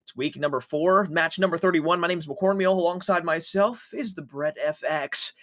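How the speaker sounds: ordinary talk, nothing marked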